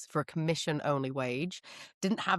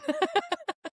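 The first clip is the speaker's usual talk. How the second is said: high laugh. Real, not acted. Laughs at own remark.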